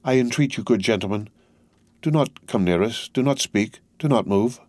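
ordinary delivery

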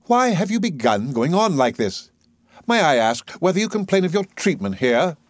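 high-pitched, haughty questioning; lofty, superior interrogation. A narrator's reading. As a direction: talk down to her grandly. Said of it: "Higgins speaks in his loftiest manner".

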